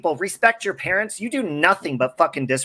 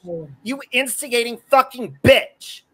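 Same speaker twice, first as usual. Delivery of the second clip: raised-pitch furious insult, spat out; 3 semitones higher than usual. Real, not acted. Son cursing his mother on stream, furious insult. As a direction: lash out at her with real fury.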